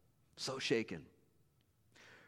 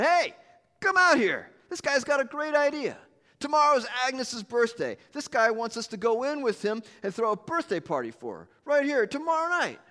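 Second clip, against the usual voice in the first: high, loud call to the back room; shouting to wife; 11 semitones higher, 6 dB louder than usual. Acted. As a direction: shout to the kitchen, all excited. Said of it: "he shouted".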